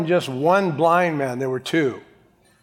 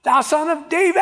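desperate full-out scream for attention; very loud yell; 13 semitones higher than usual. Acted. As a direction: scream it out, stretching the last word. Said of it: "he yelled very loud".

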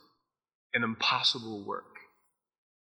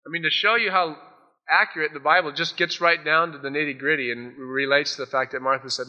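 ordinary delivery

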